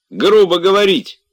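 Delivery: high, loud rebuke; indignant scolding. A narrator's reading. Master rebuking servant for rudeness, indignant. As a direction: burst out in high outrage.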